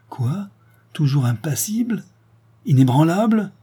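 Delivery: high, rising disbelieving questions; appalled that nothing moves him; incredulous. A narrator's reading. Duchess incredulous at unmoved son.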